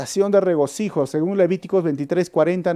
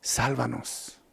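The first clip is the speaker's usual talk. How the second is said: much softer, lower sálvanos; quiet contrasting sálvanos; hushed plea; 5 semitones lower, 13 dB quieter, much slower than usual. Retold or imitated. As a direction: barely breathe it out. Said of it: "Hoy decían".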